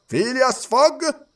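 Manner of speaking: high, slow roll call; clerk calling out. A narrator's reading. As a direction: call the name across the courtroom. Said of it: "chiamò il cancelliere Oysterpuff". (cancelliere che chiama, acuto e lento)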